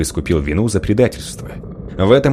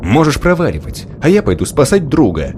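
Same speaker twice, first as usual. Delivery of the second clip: raised, snapping voice; angry bark; 6 semitones higher, 3 dB louder than usual. Acted. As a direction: snap at her angrily. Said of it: "рявкнул".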